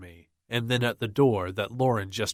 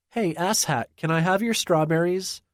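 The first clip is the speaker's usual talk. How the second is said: put-on high, cheeky child voice; a bratty little girl asking for food; cheeky kid; 8 semitones higher, 3 dB louder than usual. A narrator's reading. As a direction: tease him like a bratty kid. Cheeky young daughter voiced.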